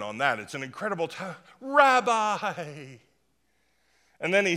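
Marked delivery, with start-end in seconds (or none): 1.6-3.0 a fawning, drawn-out Rabbi; butter him up with the word Rabbi. Retold or imitated. Nicodemus flattering Jesus, a fawning Rabbi.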